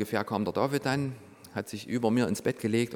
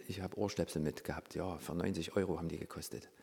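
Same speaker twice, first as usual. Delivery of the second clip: soft, small voice, playing sheepish; meek quiet answer; 4 semitones lower, 7 dB quieter than usual. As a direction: answer softly like a caught child. Meek replies to the Holy Spirit.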